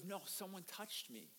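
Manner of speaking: acted calm, hushed reply; soft, unhurried correction; quiet insistence. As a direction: drop the volume and gently insist. Jesus calmly insists amid the crowd.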